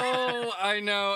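high laughing reply; laughing admission. Retold or imitated. Answering the nurse, laughing high. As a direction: giggle it out, caught again. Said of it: "I was like".

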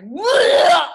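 loud, high Whoa; imitating the dog going Whoa, loudly; mock startled yell. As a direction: voice the dog's startled outburst. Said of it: "he's like".

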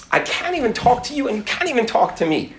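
high, fed-up protest without shouting; retold exasperation. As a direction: act out being too upset to talk. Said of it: "I was in that mood".

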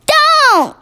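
high, falling storybook wail; pleading cry. A narrator's reading. BFG begging Sophie to stop talking, high pained cry. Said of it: "cried the poor B F G".

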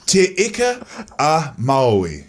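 Te ika a Maui said very slowly, each syllable separate; slow careful. Shown as a demonstration. Host slowly retrying the island name after a mistake. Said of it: "slow careful retry".

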